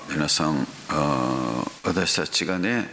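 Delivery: ordinary talk, nothing marked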